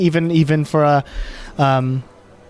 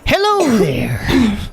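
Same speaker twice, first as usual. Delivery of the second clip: customer service voice; 3 semitones higher than usual. Acted. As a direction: put on a bright sales voice. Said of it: "all cheery".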